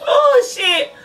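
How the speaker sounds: laughing mock panic. Retelling her stomach trouble in the car.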